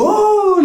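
a high, drawn-out exasperated "Åh!"; mock exasperated groan. The local man at the airport groans high at the stubborn traveller. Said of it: "Så han bara".

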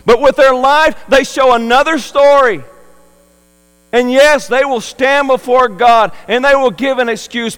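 loud, high preaching; fiery preaching. Real, not acted. Preacher warns the church about empty excuses, loud and fervent. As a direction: press the warning hard on the congregation.

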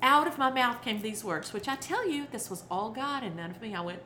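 usual speaking voice

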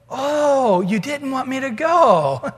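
Balaam finally gets it, cry it out; sudden realization. Acted. Balaam realizes, sees the angel.

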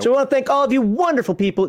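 high-pitched put-on host voice; fake voice. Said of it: "I'm using my fake voice now".

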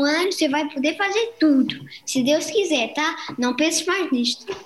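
put-on lively Brazilian YouTuber accent; Brazilian Portuguese accent. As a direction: greet the fans like a Brazilian vlogger. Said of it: "sotaque brasileiro".

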